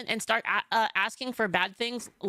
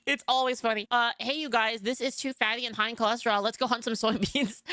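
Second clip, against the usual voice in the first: normal talking voice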